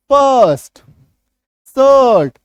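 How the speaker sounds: बर्ड and बर्थ said loud with the hidden अ sound; loud demo words. Shown as a demonstration. Teacher showing class the hidden अ sound, loud. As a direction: call out बर्ड, बर्थ loudly, stressing the अ vowel. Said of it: "यहाँ पे अ छुपा हुआ है".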